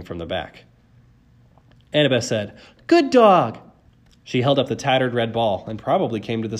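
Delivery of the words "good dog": high, ringing praise; loud dog praise. A narrator's reading. Girl to Cerberus from behind, praising loudly. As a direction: reward the dog at full voice.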